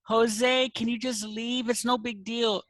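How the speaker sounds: high-pitched voice for the secretary; secretary calling out. Retold or imitated. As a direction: call across the room, pleading a little.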